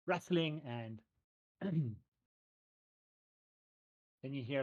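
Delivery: ordinary delivery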